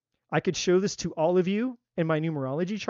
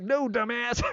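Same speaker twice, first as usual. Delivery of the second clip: high-pitched mock exasperation; exasperated retort; 6 semitones higher, slower than usual. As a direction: toss back a high, exasperated comeback in play. Acted retort to the clueless man.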